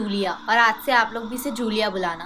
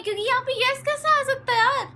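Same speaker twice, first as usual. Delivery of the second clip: much higher, pleading and nervous; scared disbelief; 13 semitones higher than usual. Real, not acted. Scared girl asks her sibling how the game said yes. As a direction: ask it like you can't believe it.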